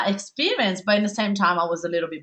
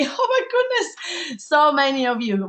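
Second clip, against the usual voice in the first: laughing through it, pitch jumping high and slow; an overwhelmed, happy laugh as she reads the names; laughing delight; 6 semitones higher than usual. Real, not acted. Host greeting webinar attendees, laughing with delight.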